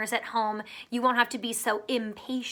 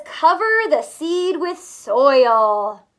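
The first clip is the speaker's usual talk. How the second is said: slow, louder storybook reading; slow, emphatic read-aloud; reading to children; 10 dB louder, much slower than usual. A narrator's reading. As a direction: read the instruction out clearly to kids. Reads instruction from book.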